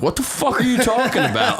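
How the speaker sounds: high-pitched retort of an offended stranger; mock indignant. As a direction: act out an incredulous brush-off. Retold angry stranger's reply to him.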